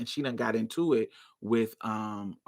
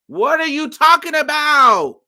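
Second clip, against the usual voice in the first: loud, high-pitched cry of disbelief; incredulous outburst, high and loud; exasperated disbelief; 12 semitones higher, 9 dB louder than usual. Retold or imitated. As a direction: act out yelling at a friend, baffled. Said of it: "this is literally how I'm talking to her".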